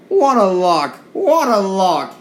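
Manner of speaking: high, loud, gleeful cry; thrilled engine crowing with delight; excited glee. Acted. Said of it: "James feel excited".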